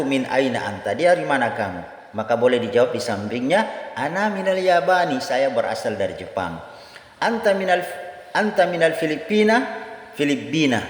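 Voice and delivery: ordinary talk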